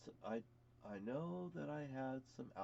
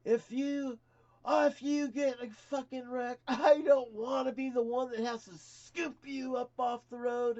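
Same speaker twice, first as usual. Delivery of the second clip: hammed-up furious trooper, much higher and louder; angry state trooper; 11 semitones higher, 10 dB louder than usual. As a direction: hammer the question like an outraged cop. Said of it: "And he goes".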